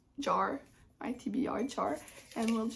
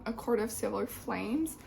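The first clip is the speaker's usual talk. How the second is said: ordinary talk, nothing marked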